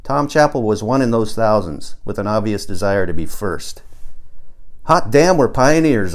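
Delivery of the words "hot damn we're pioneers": higher, louder whoop of delight; exclaiming. A narrator's reading. Narrator rallying the rocket group, exclaiming. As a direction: cheer it out. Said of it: "I exclaimed".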